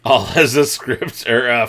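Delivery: normal talking voice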